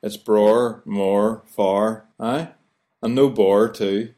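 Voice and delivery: bror, mor, far with the th dropped, Belfast style; Belfast pronunciation. Shown as a demonstration. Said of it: "we don't say other or brother".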